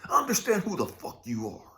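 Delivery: ordinary talk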